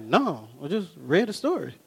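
low, soft, matter-of-fact answer; small, low deadpan reply; understated reply. Retold or imitated. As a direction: answer quietly, acting unmoved. Husband's understated reply to tearful wife.

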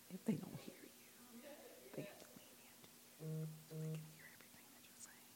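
breathy whisper meant only for a partner to hear. Shown as a demonstration. Pastor showing the congregation how to whisper, hushed whisper. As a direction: drop to a whisper so they must lean in. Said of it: "you didn't hear everything that I said".

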